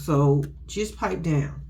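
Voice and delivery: drawn-out put-down; slow it down and dismiss him; slow, firm brush-off; slow, dismissive. Acted. Telling Troy off.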